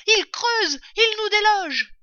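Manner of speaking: urgent high-pitched yelling; alarmed yell. A narrator's reading. Father fox warns family of the diggers, yelling. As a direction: shout high and hard in warning. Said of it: "hurla-t-il".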